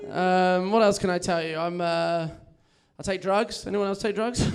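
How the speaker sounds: ordinary talk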